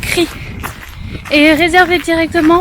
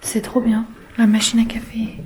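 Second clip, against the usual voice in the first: whispering; 4 semitones lower than usual. Real, not acted. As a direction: whisper the tour so nobody wakes. Quiet home tour, whispered.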